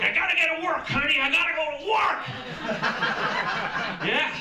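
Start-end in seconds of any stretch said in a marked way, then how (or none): 0.0-2.2 loud, high TV-drama urgency; American TV worker. Acted. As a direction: shout it like an overwrought American drama. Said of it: "Everything on American T V is about work".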